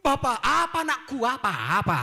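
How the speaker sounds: put-on high, loud child snapping back; child answering back. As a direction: answer cheekily like a kid.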